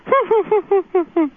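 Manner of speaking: a short high chuckle, like the car laughing; playful imitation of a laughing car noise; imitating the car's laugh. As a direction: mimic a squeaky car laughing at you. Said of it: "It goes like".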